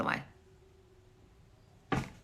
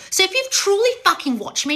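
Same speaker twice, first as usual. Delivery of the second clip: exasperated outburst; 11 semitones higher, 3 dB louder than usual. Real, not acted. Scolding a commenter.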